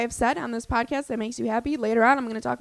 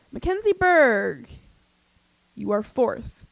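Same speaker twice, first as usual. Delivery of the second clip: announcer imitation; 4 dB louder, slower than usual. Quoting Phil announcing.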